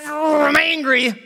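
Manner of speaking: high, loud, drawn-out growl of anger; hammed-up slow childish rage; angry kid. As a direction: stretch it out like a kid about to burst. Acted kid yelling at parent.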